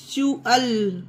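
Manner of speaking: च्यूअल broken as "choo-ul"; ch sound for T. Shown as a demonstration. Teacher showing the ending, च्यूअल with "ch". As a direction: separate च्यूअल into two clear parts, "ch" first. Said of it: "टी को चा पढ़ा गया है".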